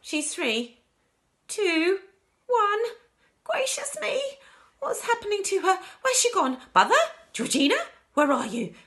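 high, loud, mounting panic; crying out. A narrator's reading. Daughter counting down as mother vanishes, crying out. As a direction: call out in rising alarm. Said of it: "Missus Bucket cried out".

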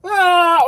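high, loud yelp; short high scream; startled yell. Acted. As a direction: yell as if flung through the air. Someone thrown into the cell.

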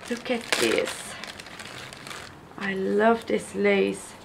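ordinary talk, nothing marked

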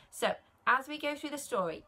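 ordinary delivery

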